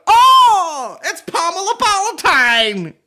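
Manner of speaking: huge high shriek, stretched out; hammed-up squealing astonishment; mock big reveal. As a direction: wail the name in fake amazement. Mimics the show's big reveal.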